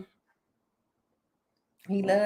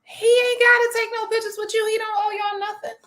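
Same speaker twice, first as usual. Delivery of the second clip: much higher, louder defending bystander voice; high put-on voice of a fan from the crowd; high mock bystander; 3 dB louder than usual. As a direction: play a loud stranger sticking up for him. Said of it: "you hear somebody say".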